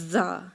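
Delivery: buzzing زا through the teeth; a clear buzzing z in زا; Arabic zaa. Shown as a demonstration. Teacher demonstrates زا. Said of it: "ویسے نکالیں گے".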